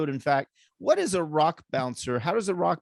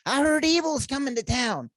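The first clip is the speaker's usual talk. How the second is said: higher disguised caller voice; changed voice; 11 semitones higher than usual. Retold or imitated. Stuntman phoning the hotel again in another fake voice. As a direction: call back sounding like someone new. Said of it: "he would change his voice several times".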